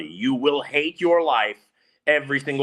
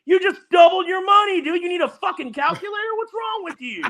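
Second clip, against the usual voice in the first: exasperated rant; 3 dB louder than usual. Real, not acted. Ranting at the complainer.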